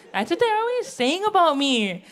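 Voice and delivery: ordinary talk, nothing marked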